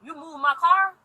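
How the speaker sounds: imitating the angry tow man; mocking the man. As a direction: ham up his indignant question. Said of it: "he came back and was like".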